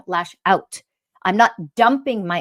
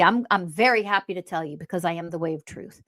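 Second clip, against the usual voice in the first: ordinary talk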